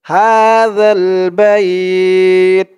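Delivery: "বাইত" stretched on the glide, closed on t; pausal recitation. Shown as a demonstration. Teacher shows the stop at verse end, "বাইত" held long. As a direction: slowly chant "বাইত", holding the vowel before stopping on t. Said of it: "ওয়াকফ করার কারণে উচ্চারণ হবে".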